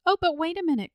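high, loud urgent call; crying out. A narrator's reading. Charlotte stopping the man at the gate, crying out. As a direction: cry out to stop someone leaving. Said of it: "cried Charlotte".

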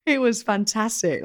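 excited. Real, not acted. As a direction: gush about a fun day. Enthusing about a wedding.

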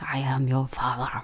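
a soft, breathy movie-villain reveal of "I am your father"; hushed dramatic father. Retold or imitated. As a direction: whisper it like a film reveal. Dad's dramatic phone reveal.

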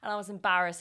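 ordinary talk, nothing marked

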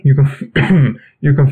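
clearing a sick throat mid-sentence; coughing fit. Real, not acted. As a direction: push through a nagging cough. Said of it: "I apologize for coughing throughout this".

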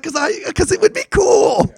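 high shouted protest that it would be cool; excited shout. Retold or imitated. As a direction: blurt it out with childish delight. Insisting the photo would be cool.

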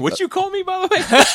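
high, loud, mock outrage; mock offended. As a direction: squeak it out indignant.

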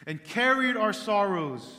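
and carried our sorrows proclaimed loud and high, carried hit hard; loud preached reading; full-force preaching. Acted.

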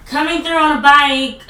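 loud mock announcement to the crowd, pitch up; loud call out. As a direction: call out to clear the path, half laughing. Pushing through crowd on bike.

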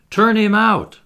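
high, loud crowd roar; exasperated roar. A narrator's reading. Exasperated crowd wants the heckler gone, roaring. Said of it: "roared the exasperated crowd".

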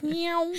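meowing like a cat while riding off; mock meow. Drunk friend on a bike, meowing at the street.

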